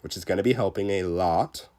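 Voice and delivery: usual speaking voice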